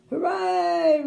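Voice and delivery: loud, high crowd cheer; roaring cheer. A narrator's reading. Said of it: "roared the crowd".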